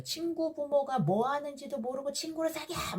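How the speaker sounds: high, held-back imitation of a shout; mock scolding yell. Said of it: "그러면 또 소리를 질러요".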